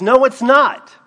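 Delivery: loud, high shouted denial; angry man's retort. Retold or imitated. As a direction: snap back in despair.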